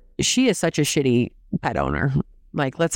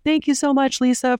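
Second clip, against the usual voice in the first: mock gushing thanks; 9 semitones higher than usual. Mock FaceTime gushing to Lisa.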